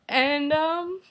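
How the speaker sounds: high, tearful voice breaking through; choking up mid-sentence; fighting back tears; starting to cry. Real, not acted. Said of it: "yeah, I'm crying right now".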